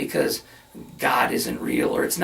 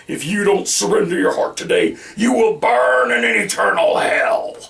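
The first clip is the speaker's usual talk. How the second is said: loud fire-and-brimstone threat, rising to a shout; hellfire preaching; 3 semitones higher, 6 dB louder than usual. Retold or imitated. Preacher imitating hellfire sermons that scare people, loud and rising. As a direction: thunder it like a revival preacher. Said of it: "hell, fire, and brimstone".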